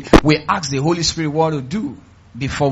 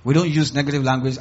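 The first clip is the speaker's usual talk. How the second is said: normal talking voice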